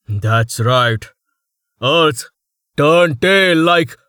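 loud boastful noble; loud boasting. Acted. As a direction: crow it out loud.

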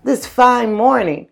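high, loud cheerful bug voice; carefree grasshopper. Acted. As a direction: bounce it like a happy-go-lucky grasshopper. Grasshopper greets the ant.

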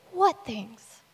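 simple, innocent question, understated; not sarcastic. Acted. Jesus replying to Cleopas, not sarcastic. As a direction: ask it plainly, without sarcasm. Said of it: "Jesus is not sarcastic".

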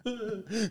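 short high burst of laughter. Real, not acted. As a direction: break into a high giggle. Laughing at the gnat.

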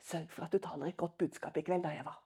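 a hushed warning from the side; whispered aside. Retold or imitated. Nervous leader whispering to the preacher before the sermon, soft. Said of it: "hvisket inn i øret".

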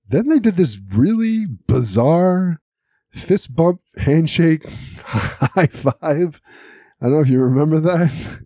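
high, laughing disbelief; amused disbelief. Real, not acted. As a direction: tell it like you can't believe it. Describing a bizarre handshake.

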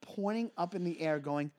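ordinary talk, nothing marked

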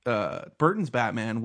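ordinary talk, nothing marked

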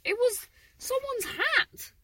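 high, slow, incredulous reveal; amazed reveal. Real, not acted. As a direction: let the absurd surprise lift it up high. Reveal of odd sleeve find.

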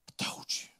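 hissed taunting whisper; Satan whispering to Jesus; Satan's whisper. Acted. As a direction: whisper it like the devil in his ear. Satan taunting Jesus before execution.

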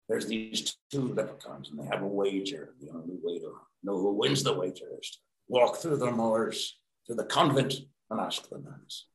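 lightly done, soft Irish lilt; a hint of joke-telling Irish, kept low; made-up Irish accent. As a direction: tell it quietly in a homemade Irish accent. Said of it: "that joke, and that's the accent".

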